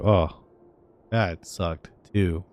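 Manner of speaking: ordinary delivery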